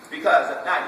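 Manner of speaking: usual speaking voice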